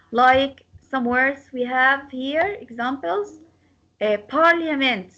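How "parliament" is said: "parliament" with weight on PAR; stress on first syllable. Shown as a demonstration. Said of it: "stress will be on the first part".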